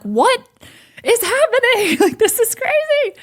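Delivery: high-pitched giggly mock squeal of disbelief; retold freak-out. Startled worshipper stomping uncontrollably, squealing in giddy disbelief.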